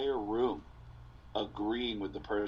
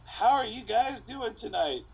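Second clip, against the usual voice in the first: higher, slow eager host voice; nervous new comic; 8 semitones higher, 4 dB louder than usual. Retold or imitated. New comic greeting a pizza bar crowd, in a higher eager voice. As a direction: play a green opener greeting the room. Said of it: "most awkward delivery".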